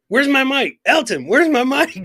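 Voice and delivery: high, loud mock complaint; put-on high-pitched demand; Chris. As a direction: imitate someone calling out indignantly for his things. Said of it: "like, when Chris goes".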